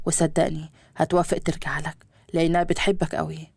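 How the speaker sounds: a father's low gentle voice, pitched well below the narration; calm low fatherly pleading. Acted. Father coaxing his son back to his mother, low and gentle.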